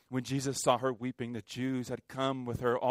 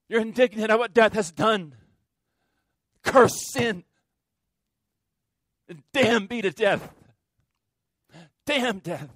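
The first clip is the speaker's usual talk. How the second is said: loud, higher, fierce cry against death; indignant anger; 8 semitones higher, 7 dB louder than usual. Real, not acted. Grieving preacher raging at death before the congregation, loud fury. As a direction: spit out the curse on death.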